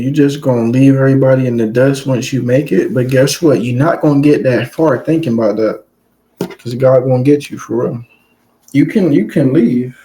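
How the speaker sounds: ordinary talk, nothing marked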